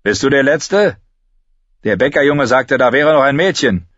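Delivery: a hint of a gruff soldier interrogating. Soldier questioning Lommi.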